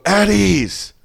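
high shouted call to attention. Retold or imitated. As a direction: call it like a barracks order. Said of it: "so someone".

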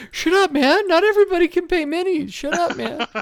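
high, laughing shut up man; laughing retort. Retold or imitated. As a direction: laugh through a mock complaint. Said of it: "I'm like".

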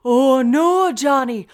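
loud, high, overdone fake Irish accent, crying out; bad Irish accent. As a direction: ham up a terrible stage Irish accent. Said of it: "accent was like".

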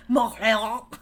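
Montreal with a throaty French r, through laughter; a hammed-up French try on Montreal; mock French accent again. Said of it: "she did it again".

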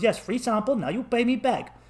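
high-pitched pushy demand; insistent dealer. Retold or imitated. Dealer insists the tourist pay, high and pushy. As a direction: imitate a dealer who won't back off. Dealer argues over free sample.

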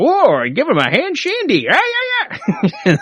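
high, slow hammed-up radio jock voice; sleazy radio host impression, played for laughs; mock shock jock. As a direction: ham up a leering shock jock. Mimics shock jock on air.

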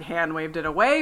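usual speaking voice

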